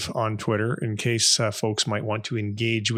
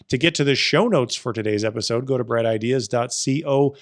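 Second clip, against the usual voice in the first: louder, higher host sign-off; podcast outro; 4 semitones higher, 4 dB louder than usual. Acted. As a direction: wrap up the show like a radio host.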